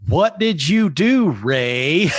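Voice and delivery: high, loud, slow accusation; accusing. Acted. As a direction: demand it loudly and slowly. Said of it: "I lean over and I go".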